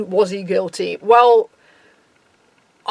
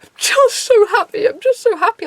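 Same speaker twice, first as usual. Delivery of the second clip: imitated happy sobbing, pitched way up; high-pitched parody of a weeping, overjoyed friend; tearful; 11 semitones higher, 3 dB louder than usual. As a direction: squeal it through mock tears of joy. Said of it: "in tears about the whole thing".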